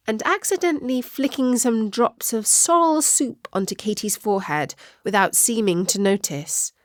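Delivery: usual speaking voice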